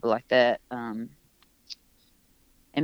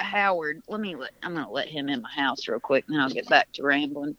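usual speaking voice

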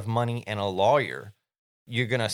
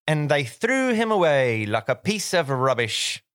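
a lightly mimicked British storyteller, louder and higher; British accent; 5 semitones higher, 5 dB louder than usual. Remembering a childhood British reading of a sad book, mildly mocked. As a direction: read it like a British cartoon narrator. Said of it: "it was in a British accent".

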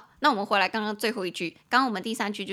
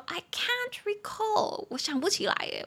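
high, slightly hesitant "I can't recall"; mock puzzled reply; 11 semitones higher than usual. Replying to a friend who asks about someone, high and unsure. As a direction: answer as if searching memory. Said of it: "你就可以说".

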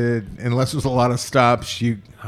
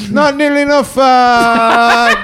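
mock Canadian accent; 9 semitones higher than usual. Cohost redoing the Canadian line, mock accent. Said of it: "a little bit of an Irish thing".

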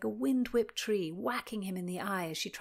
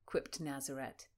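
ordinary delivery

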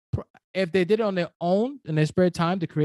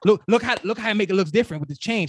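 excited; 4 semitones higher, 5 dB louder than usual. Retold or imitated. Excited showing wife his hat.

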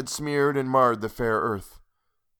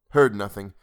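usual speaking voice

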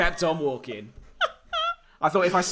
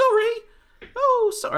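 much higher-pitched child imitation; apologetic kid; 7 dB louder than usual. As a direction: put on a high little kid's apology.

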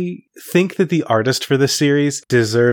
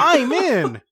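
higher hammed-up cracker voice; 10 semitones higher than usual. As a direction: squeak it out as a silly cracker. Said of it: "Cracker voice".

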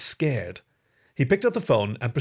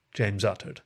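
plain reading voice

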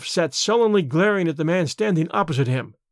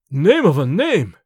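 a loud, slow, startled shout as Rokoff; 5 dB louder, slower than usual. A narrator's reading. Rokoff shouting at the sudden visitor, startled shout. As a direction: bellow it out slow, caught off guard. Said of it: "he shouted".

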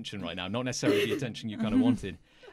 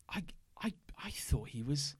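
voiceless whisper, half to himself; whispered aside; 8 dB quieter than usual. Acted. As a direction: whisper it, shaken.

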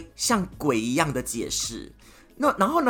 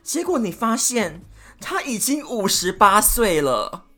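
ordinary delivery

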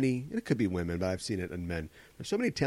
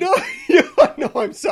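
laughing high and loud; 10 dB louder than usual. Real, not acted. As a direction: apologize through laughter. Laughing apology.

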